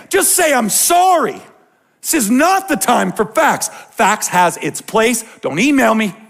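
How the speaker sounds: high, loud preacher's cry; loud, high rising sermon peak; fervent preaching. Acted. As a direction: drive the point home from the pulpit. Pastor preaching at full force.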